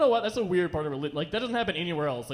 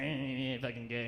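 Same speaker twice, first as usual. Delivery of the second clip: disgusted aunt; 6 semitones lower, 11 dB quieter than usual. Retold or imitated.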